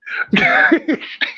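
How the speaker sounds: a very high giggle; high squealing giggle; squeaky high laugh. Real, not acted. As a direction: let out a squeaky burst of laughter. Laughing at the story.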